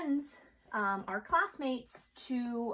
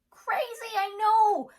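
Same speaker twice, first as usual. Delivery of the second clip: much higher, loud burst of amazement; wide-eyed wow, pitched way up; amazed; 14 semitones higher, 9 dB louder, slower than usual. Real, not acted. Reacting to the banana fact.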